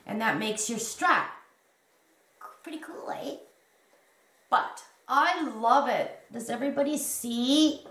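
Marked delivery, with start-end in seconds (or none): 2.6-3.4 a breathy aside whispered to the viewers. Real, not acted. Whispering to the viewers about her strap idea. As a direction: lean in and whisper it like a secret.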